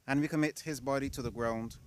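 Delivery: ordinary delivery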